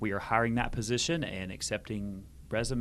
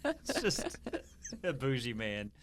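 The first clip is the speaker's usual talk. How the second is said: laughing; 3 semitones higher, slower than usual. Real, not acted. Repeating the punchline.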